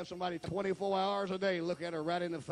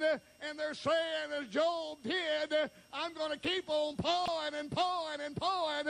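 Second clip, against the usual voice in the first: full-force preaching; 12 semitones higher than usual. Acted. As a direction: preach it out to the whole church.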